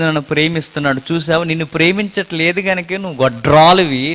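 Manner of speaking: ordinary delivery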